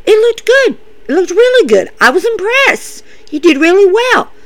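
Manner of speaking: high-pitched, insistent reassurance; Randy. Retold or imitated. Randy reassures Matt it looked good, high imitated voice. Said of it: "Randy goes".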